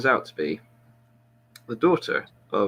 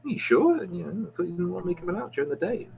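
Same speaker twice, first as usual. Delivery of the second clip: much higher, hesitant voice, then quick; squeaky surprised homeless man; 8 semitones higher, faster than usual. Retold or imitated. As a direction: squeak it out, unsure he's allowed. Joke, homeless man surprised by captain.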